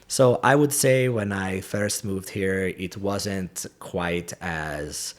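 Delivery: careful accented English with crisp T's; old foreign accent. Shown as a demonstration. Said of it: "my T's are a little more T".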